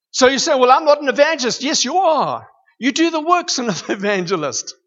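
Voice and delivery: loud, high, fast excited preaching; getting excited. Real, not acted. Preacher excitedly answers a doubting listener, loud and high. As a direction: fire it out like you can't hold back. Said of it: "I'm getting excited".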